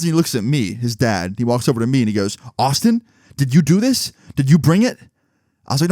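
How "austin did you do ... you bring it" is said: raised, higher-pitched accusing questions; acted interrogating dad. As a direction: confront a kid like an alarmed dad.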